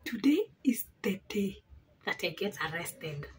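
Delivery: despairing whisper. Retold or imitated. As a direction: whisper it in dread. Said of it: "So I'm like".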